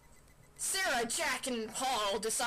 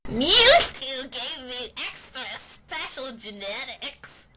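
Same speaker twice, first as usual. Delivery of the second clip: Umbreon voice; 13 dB louder than usual. Acted.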